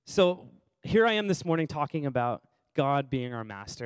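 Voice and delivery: ordinary delivery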